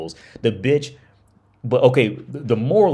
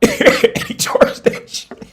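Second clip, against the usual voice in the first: talking through a high wheezing laugh; slow words squeezed out between laughs; 8 dB louder, slower than usual. Real, not acted. Laughs at the price.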